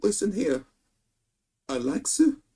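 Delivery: high leering voice, mock menacing; booty warrior impression. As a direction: play a creepy inmate making a threat. Acted Boondocks character quote.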